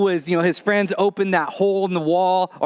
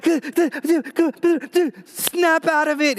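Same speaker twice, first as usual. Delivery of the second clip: stuttering panic; 9 semitones higher than usual. Retold or imitated. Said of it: "And I'm like".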